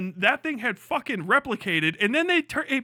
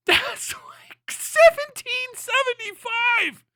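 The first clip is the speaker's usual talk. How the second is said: high, cracking laugh-talk; laughing disbelief; 12 semitones higher, 4 dB louder, much slower than usual. Real, not acted. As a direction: choke the year out through laughter.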